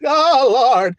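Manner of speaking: high, loud mock sob to God, slowing as it goes; hammed-up tearful plea ending in a cry; whiny begging. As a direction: beg like a pitiful wretch, half crying. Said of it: "Begging God".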